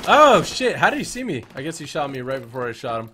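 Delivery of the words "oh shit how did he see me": startled yelp, higher and louder; panicking gamer. Real, not acted. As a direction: caught off guard, shout it. Shot by an enemy.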